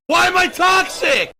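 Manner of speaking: high, loud outburst; indignant shout. Acted. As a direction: fire it back at the haters, shouted. Defiant outburst at critics.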